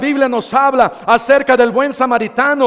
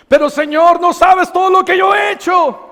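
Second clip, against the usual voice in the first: high, strained pleading voice, pitch up; pleading sinner; 6 semitones higher than usual. Acted. Sinner begging the Lord, cadenas too strong: high strained plea. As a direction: cry out to the Lord as a desperate sinner.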